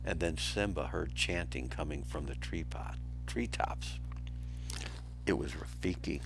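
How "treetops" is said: plain reading voice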